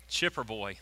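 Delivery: ordinary delivery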